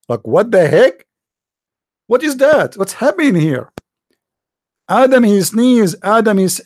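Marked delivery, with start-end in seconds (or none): none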